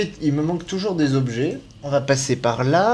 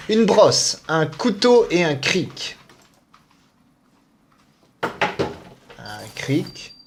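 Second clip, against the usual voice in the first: normal talking voice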